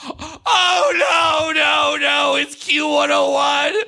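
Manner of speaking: high, wailed radio station ID; hammed-up anguished cry; mock on-air breakdown. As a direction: wail it out like a DJ falling apart. Acts out crying through radio break.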